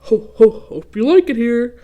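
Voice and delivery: loud jolly ho-ho laugh; laughing Santa. Acted. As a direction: boom it out like Santa. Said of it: "laughed a wooden Santa".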